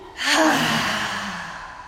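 long audible exhale sigh. Shown as a demonstration. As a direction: let the breath out with a sigh. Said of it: "One more time".

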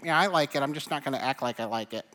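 lightly voiced reserved churchgoer; a hint of grudging West Michigan restraint; mock subdued congregant. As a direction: imitate someone unwilling to show they like it. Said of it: "You're so subdued. We're so West Michigan".

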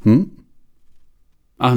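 ordinary delivery, nothing marked

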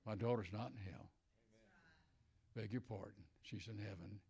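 ordinary talk

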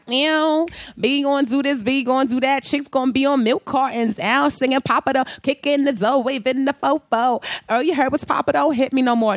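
put-on high singsong teen rapping along; oblivious teenager. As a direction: rap along like a clueless kid. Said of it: "I'm all a teenager like".

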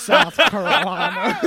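high, slow cartoon drawl, very loud; laughing, exaggerated Southern cartoon voice; Cleveland Brown. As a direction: stretch it out through laughter. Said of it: "Sound like Cleveland Brown".

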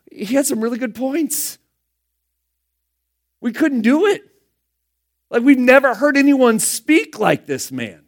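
mock defensive officers, high-voiced; helpless excuse. Officers excuse themselves to Pharisees.

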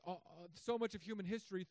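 usual speaking voice

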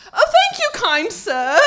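put-on high, loud, posh-sounding English accent. Thanking a gentleman at the Chipotle door in a full English accent. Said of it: "went full English accent".

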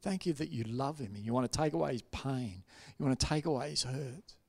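soft, hushed prayer; prayer of love. Retold or imitated. Pastor praying over a hurting man, soft and loving. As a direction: pray softly and tenderly over someone. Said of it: "prayed a prayer of love".

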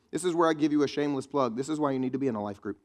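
usual speaking voice

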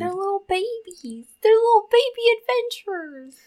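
cooing. Real, not acted. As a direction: gush over them like babies.

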